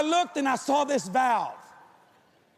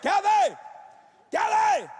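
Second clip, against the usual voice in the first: high calls rising to a yell; calling out; 7 semitones higher, slower than usual. Retold or imitated. Husband calling his wife Kelly from under the house. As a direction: call her name from under the floor.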